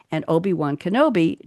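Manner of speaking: ordinary delivery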